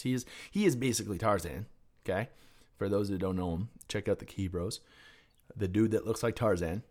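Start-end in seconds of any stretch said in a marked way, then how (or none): none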